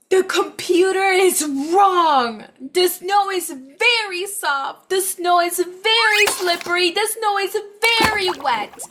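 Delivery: high, loud, indignant cat voice; Kitty fuming, high and loud; upset Kitty. Acted. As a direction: complain like a cross little cat. Angry Kitty complains about snow.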